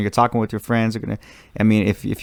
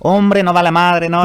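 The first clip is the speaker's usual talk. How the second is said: put-on Mexican accent; 7 semitones higher, 3 dB louder than usual. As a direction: throw it out like Mexican family. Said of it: "family being Mexican and everything".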